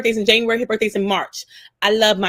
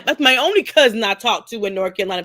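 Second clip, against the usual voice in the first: normal talking voice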